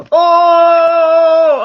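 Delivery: loud, high, held "ohhh"; hammed-up reaction to innuendo; long jokey "ohhh". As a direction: sustain a teasing whoop.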